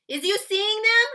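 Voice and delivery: big, loud friendly giant explaining. Acted. Giant explaining to Sophie why he took her. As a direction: boom it out like a kindly giant. BFG voiced in read-aloud.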